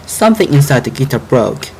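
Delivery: ordinary delivery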